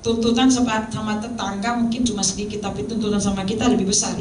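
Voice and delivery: normal talking voice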